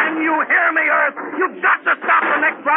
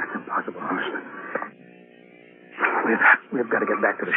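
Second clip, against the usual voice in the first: frightened whisper; 7 dB quieter, faster than usual. Acted. Captain fearful, hushed.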